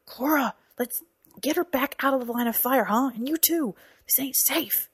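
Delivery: high, urgent character voice; urgent orders. A narrator's reading. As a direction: hurry them out of danger. Professor urgently orders them to safety.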